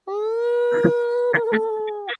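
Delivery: high shrieking dinosaur call; dinosaur screech. Retold or imitated. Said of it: "vocalization of this creature".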